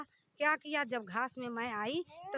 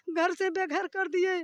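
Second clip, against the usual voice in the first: raised, higher-pitched anguished protest; anguished outburst; 5 semitones higher, 5 dB louder than usual. Real, not acted. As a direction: voice climbing loud and high in distress. Distressed complaint about losing home.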